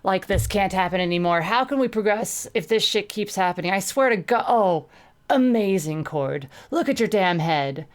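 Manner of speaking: loud, agitated rant, pitch climbing. Acted. Tourniquet ranting at battered Cord, loud and angry. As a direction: rant at her, fed up. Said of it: "had him ranting already".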